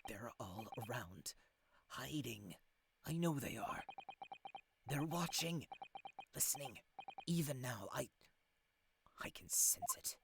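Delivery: low, hushed, halting mutter sinking to a whisper; paranoid prisoner. Acted. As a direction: whisper it as if being watched. Paranoid prisoner muttering about watchers.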